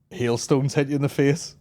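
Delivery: normal talking voice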